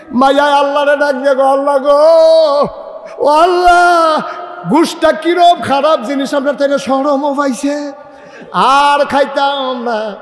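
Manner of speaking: full-force preaching. Real, not acted. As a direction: ring it out to the crowd, pitched high.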